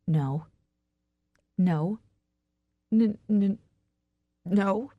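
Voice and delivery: halting, broken attempts at denial; stammering. A narrator's reading. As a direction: stumble and stick on the first sound. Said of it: "Now I'm stammering".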